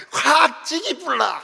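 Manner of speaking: mock Gyeongsang brawler, slow high shout; Gyeongsang dialect threat. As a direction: yell it drawn out in Gyeongsang dialect. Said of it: "경상도 사람들 싸우는 거 보면 경상도 말로".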